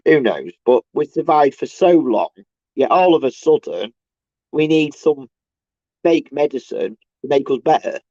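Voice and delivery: ordinary talk, nothing marked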